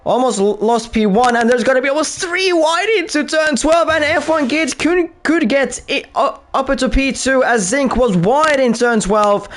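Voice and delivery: high, fast excited race call; excited commentary. Real, not acted. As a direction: call the chaos with rising excitement.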